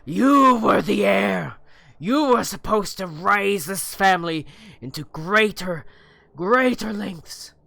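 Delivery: roaring with rage; furious father. Acted. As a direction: bellow it at your child.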